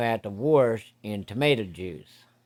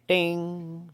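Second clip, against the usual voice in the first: hose bell ding; 7 semitones higher than usual. Retold or imitated.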